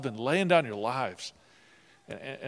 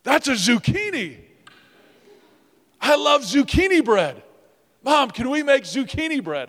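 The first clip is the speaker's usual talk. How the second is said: put-on high, loud, bright child's voice; squeaky excited kid; excited little boy; 13 semitones higher, 10 dB louder than usual. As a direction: play an eager little kid begging his mom. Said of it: "with bright face".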